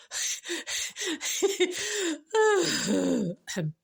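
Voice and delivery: high, drawn-out giggle; helpless giggle tailing into a sigh; laughing. Real, not acted. Storyteller cracking up at the seagull joke, laughing.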